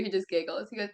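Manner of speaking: ordinary delivery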